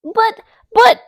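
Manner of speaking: high squeaky sputter; a flustered duck squeaks and sputters; loud, high squeak of protest. Acted. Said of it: "squeaked or sputtered Delilah".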